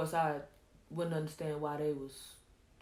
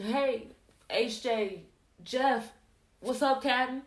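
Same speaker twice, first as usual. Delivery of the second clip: higher, louder hail to buddies; raised-voice hello, trying to get their attention; calling out a greeting; 6 semitones higher, 6 dB louder, slower than usual. Acted. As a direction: call out to each comrade in turn. Acted greeting to staring comrades.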